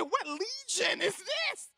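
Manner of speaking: mock horrified question; alarmed joke. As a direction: ask it wide-eyed and spooked. Joke about demons.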